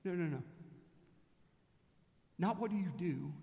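ordinary talk, nothing marked

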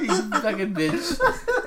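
slow, high insult through laughter; laughing mock insult. Real, not acted. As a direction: drag out the curse while laughing. Teasing friend, cracking up.

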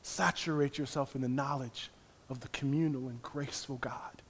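ordinary delivery

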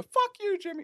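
a hint of a drunk buddy snapping back; mild mock retort from the couch; Robert's comeback. Buddy at the Risk game, lightly voiced snapping back. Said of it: "you hear him go".

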